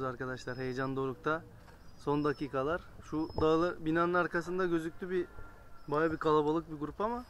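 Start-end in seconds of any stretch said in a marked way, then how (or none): none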